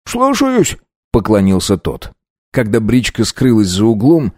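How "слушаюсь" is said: high, quick servant's reply; eager coachman. A narrator's reading. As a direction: snap to obedience. Coachman obeys. (услужливый мужик)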